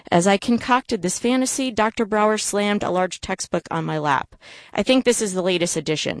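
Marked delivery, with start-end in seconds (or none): none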